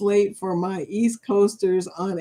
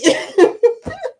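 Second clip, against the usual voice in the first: high, loud real laugh; a loud happy cackle; 14 semitones higher, 6 dB louder than usual. Real, not acted. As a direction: burst out laughing at the chat. Laughing at chat joke.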